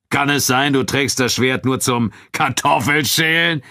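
high, projected mocking question; Abu Dun jeering. A narrator's reading. Nubian fighter mocks his friend's sword, jeering. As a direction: ridicule him before the crowd. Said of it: "höhnte Abu Dun".

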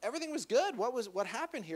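high-pitched, incredulous casual questioning; acted exasperated disbelief, pitched high; the Lord. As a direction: play a baffled buddy asking what went wrong. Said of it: "And the Lord's like".

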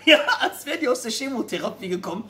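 usual speaking voice

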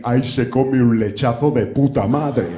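lightly voiced older-man enthusiasm, slow; a hint of a dad, drawn out; middle-aged man's remark. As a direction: savour it slowly like somebody's dad. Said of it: "la madurez me hace decir".

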